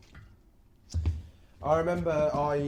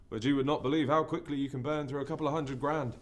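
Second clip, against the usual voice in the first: ordinary talk, nothing marked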